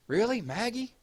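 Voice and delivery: high, rising shocked questions; can't believe the chat picked her; shocked disbelief. Real, not acted. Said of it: "I'm shocked".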